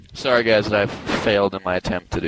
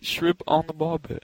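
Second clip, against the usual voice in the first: slow, higher mock-Australian catchphrase; Australian accent; 6 semitones higher than usual. Shown as a demonstration. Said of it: "You can do it like Australian".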